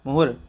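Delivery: मुहुर ending on a clear r; careful pronunciation. Shown as a demonstration. Teacher modelling मुहुर for the class. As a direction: model मुहुर with the final र sounded. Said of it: "इस तरह से पढ़ेंगे".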